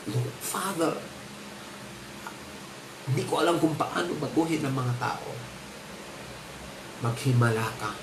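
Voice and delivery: softly spoken prayer, pausing after Father; soft pleading prayer. Acted. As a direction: plead quietly with God. Voicing a weeping person's prayer.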